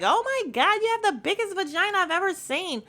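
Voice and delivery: high, hammed-up astonished squeal; mock amazement. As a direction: imitate a gushing, shocked friend, high and quick. Mimics Portia's amazed exclamation.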